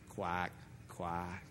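low mocking quack, quack; duck quacks. Preacher voicing the devil as a duck, low mocking quack.

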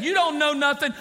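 loud, high defiant jab; mock defiance. Preacher recalling the son's defiant words, loud. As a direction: throw it back like the old rebel. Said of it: "he's no longer saying".

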